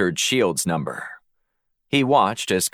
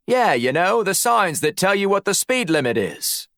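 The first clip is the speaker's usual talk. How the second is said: much higher, matey Aussie voice; Australian accent; 10 semitones higher than usual. A narrator's reading. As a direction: lay on a put-on Australian accent. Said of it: "summoned his best Australian accent".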